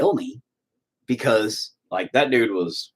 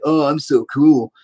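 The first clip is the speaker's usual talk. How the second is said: mock boastful; slower than usual. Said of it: "I'm not trying to sound like".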